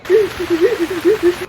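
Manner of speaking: high, loud mock sobs; imitated hard crying. Tourist asked if she was okay, mock sobbing. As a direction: mimic crying too hard to answer. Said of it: "I was crying so hard".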